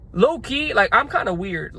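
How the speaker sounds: ordinary delivery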